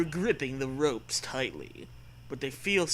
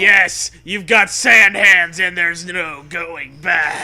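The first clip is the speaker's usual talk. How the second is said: loud, slow declaration; gleeful doom; 12 dB louder, slower than usual. Pretend. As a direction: announce the doom with relish.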